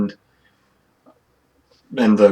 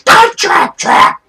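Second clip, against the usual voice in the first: very high, loud, slow chanted cheer; screechy high kobold cheering loudly; crowd cheer; 8 dB louder, slower than usual. Acted. Kobold crowd approves of the trap, high screeching cheer. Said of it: "And they cheer".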